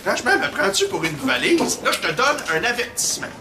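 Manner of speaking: stern scolding. Acted. Principal scolds student.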